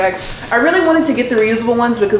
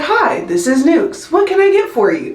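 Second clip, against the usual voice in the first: automated voice; 8 semitones higher than usual. Retold or imitated. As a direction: imitate a chirpy robot answering machine. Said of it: "this automated voice thing".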